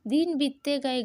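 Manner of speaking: usual speaking voice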